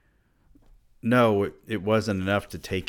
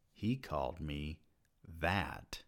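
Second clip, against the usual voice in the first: much softer, flat, falling; numb statement; 6 semitones lower, 14 dB quieter than usual. A narrator's reading. Narrator repeating the insult to himself, numb and quiet. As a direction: let it sink in quietly. Stunned inner thought.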